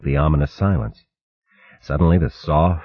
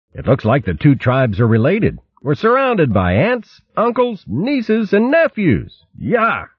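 amused; 9 semitones higher than usual. Acted. Trimp amused.

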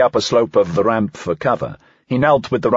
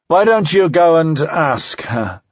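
slow, raised, bitter taunt; 7 semitones higher, 3 dB louder, slower than usual. A narrator's reading. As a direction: throw it back with contempt. Dying man's bitter retort.

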